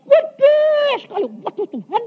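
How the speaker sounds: very high, astonished exclamation; retold amazement. Preacher relives seeing God's palace, high astonished cry.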